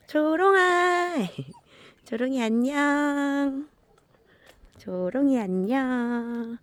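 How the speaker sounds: very high, slow sing-song greeting; high slow doting dog greeting. Real, not acted. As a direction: sing the dog's name out high and stretched.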